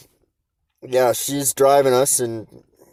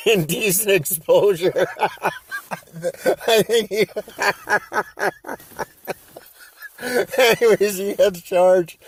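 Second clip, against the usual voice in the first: laughing through the words, pitched up; 9 semitones higher than usual. Real, not acted. Telling how his buddy got charged, laughing helplessly. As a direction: try to talk through giggles.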